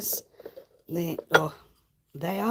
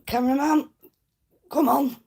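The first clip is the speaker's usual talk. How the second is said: calling out; 7 semitones higher, 5 dB louder than usual. Real, not acted. Calling out to the camera person.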